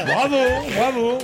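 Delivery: bravo lancé en riant; laughing. Real, not acted. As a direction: applaudis en éclatant de rire. Applaudit en riant. (en riant)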